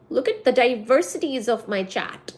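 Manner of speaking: normal talking voice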